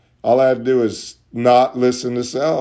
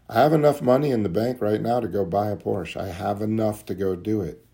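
ordinary talk

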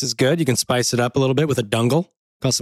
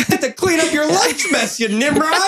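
laughing, much higher mock scolding; mock insult; 12 semitones higher than usual. Teasing a friend over his lunch mess, laughing high mock scold. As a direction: tease a friend through laughter.

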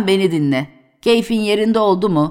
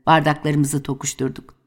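ordinary talk

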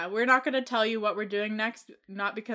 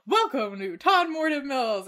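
lightly voiced old-time announcer; slow, a hint of an old recording; 1950s narrator; 5 semitones higher, 7 dB louder, slower than usual. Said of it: "this old-time voice would come up".

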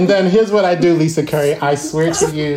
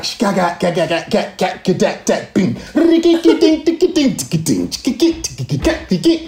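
beatboxed drum beat. Shown as a demonstration. Drummer showing the suspension beat with mouth sounds. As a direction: mouth the drumbeat rhythm. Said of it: "the beat is like".

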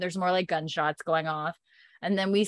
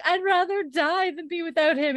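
high-pitched lovesick wail; mock melodrama; 10 semitones higher than usual. Young woman defying her elder, mock melodramatic. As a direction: protest it dramatically in a high voice.

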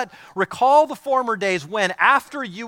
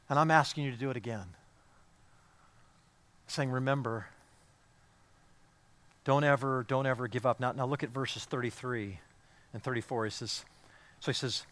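soft, lower preaching voice; hushed plea; 8 semitones lower, 10 dB quieter, faster than usual. Acted. Preacher quietly pleading with God, hushed. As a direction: pull the room in with a quiet ask.

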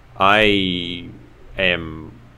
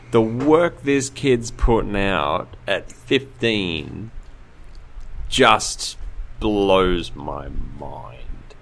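slow amazement; much slower than usual. Real, not acted. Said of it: "emphatic amazement at kid's work".

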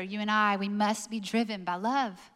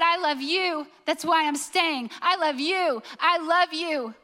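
raised, impassioned declaration, pressing each repeat; Jesus declaring love; 4 semitones higher, 4 dB louder than usual. Acted. Jesus on the cross tells you why he stays, impassioned declaration. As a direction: plead love earnestly, pitched higher. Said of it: "and he said".

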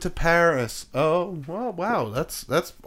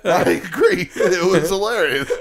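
louder, laughing; 5 semitones higher, 5 dB louder than usual. Real, not acted. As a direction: laugh while agreeing. Laughing about bad accent.

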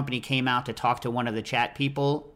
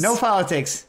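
emphatic; 7 semitones higher, 4 dB louder than usual. Pretend. Jokingly shutting down politics.

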